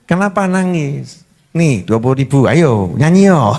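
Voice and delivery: low, coaxing parent; menghibur anak menangis. Acted. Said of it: "Kita hibur tuh yang". (membujuk anak dengan nada rendah)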